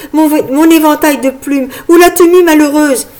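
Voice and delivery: high, agitated demand; frantic demand. A narrator's reading. Frog fairy demanding her fan from Marguerite, frantic. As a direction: press the servant impatiently.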